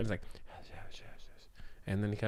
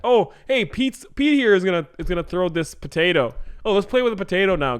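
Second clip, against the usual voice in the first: put-on high, loud sneering kid voice; mocking taunt; 6 semitones higher, 5 dB louder than usual. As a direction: jeer at him in a high voice. Kids mocking Pete.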